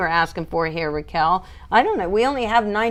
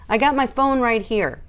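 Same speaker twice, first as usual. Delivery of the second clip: usual speaking voice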